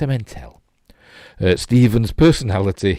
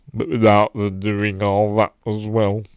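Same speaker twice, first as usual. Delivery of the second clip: [name] impression. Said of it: "[name], doesn't it".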